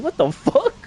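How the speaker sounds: high, laughing what the fuck; laughing disbelief. Retold or imitated. Retelling hearing voices, high laughing disbelief. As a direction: let the laugh break into the question.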